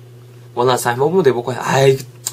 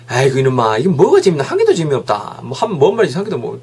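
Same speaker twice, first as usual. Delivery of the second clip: exasperated grumble. Acted. Fed-up viewer scolding a friend over a boring show, grumbling.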